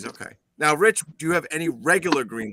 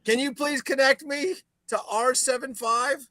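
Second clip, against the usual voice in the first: put-on old-time phone caller; 10 semitones higher, slower than usual. Calling an old-time operator.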